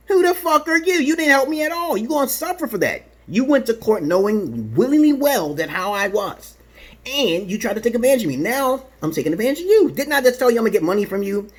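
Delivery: high-pitched, scornful confrontation; indignant tirade. Retold or imitated. Acted angry tirade at his wife.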